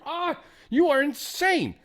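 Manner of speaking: high, drawn-out exasperated outburst; imagined scream. Retold or imitated. Retelling an argument with older brother, mock exasperated scream. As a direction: wail it slowly, way up high, without real volume. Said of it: "I didn't yell that at him".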